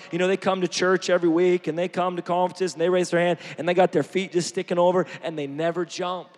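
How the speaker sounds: ordinary talk, nothing marked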